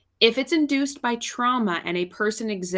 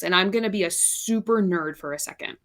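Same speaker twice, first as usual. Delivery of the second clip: ordinary talk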